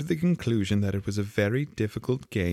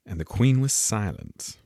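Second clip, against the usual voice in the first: ordinary delivery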